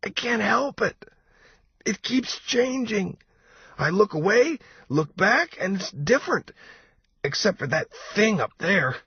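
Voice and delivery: very high, anxious babble rising toward panic; getting shrill. A narrator's reading. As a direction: climb into a shrill, panicky plea. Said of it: "His voice was getting shrill".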